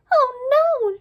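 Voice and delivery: high, loud gasp of shock. Acted. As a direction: blurt it out in a startled gasp. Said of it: "she gasped".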